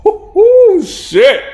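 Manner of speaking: high, loud burst of hype; excited exclamation. Real, not acted. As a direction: yelp out at a hard line. Reacting hyped to a bar.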